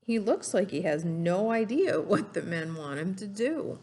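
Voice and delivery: ordinary talk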